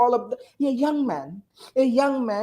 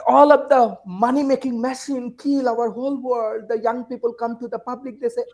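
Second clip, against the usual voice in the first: ordinary delivery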